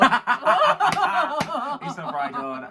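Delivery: slow, laughing through the words. Real, not acted. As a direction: stretch it out while laughing. Laughing repeat of own line.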